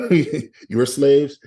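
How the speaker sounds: normal talking voice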